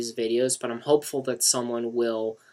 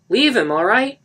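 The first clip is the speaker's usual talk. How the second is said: higher, loud call; calling after; 11 semitones higher, 13 dB louder than usual. A narrator's reading. Mark calling after Lisa. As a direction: call across the room. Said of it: "Mark called after Lisa".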